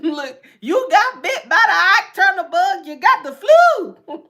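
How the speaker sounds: high, loud teasing through laughter; giggling tease to a viewer; laughing. Real, not acted.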